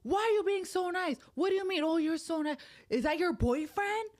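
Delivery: high, loud mock kid voice; teasing kids. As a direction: tease Mom like a nosy little son. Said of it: "They're like".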